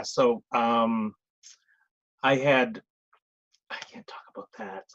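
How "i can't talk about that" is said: unvoiced hushed whisper, a quick secret aside. Real, not acted. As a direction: drop to a whisper as if it can't be said aloud. Secret he can't share yet.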